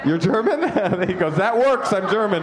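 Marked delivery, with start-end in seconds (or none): 1.4-2.4 cheerfully pleased with himself, a bit higher; jovial. Retold or imitated. Audience member answering the comic, jovially. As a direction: say it like being German is great news. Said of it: "don't be that jovial about it".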